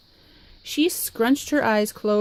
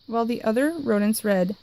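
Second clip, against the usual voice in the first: usual speaking voice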